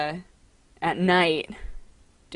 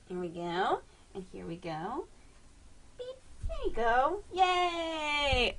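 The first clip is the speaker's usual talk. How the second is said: soft coaxing; 6 semitones higher, 10 dB quieter, slower than usual. Real, not acted. Settling cat on lap.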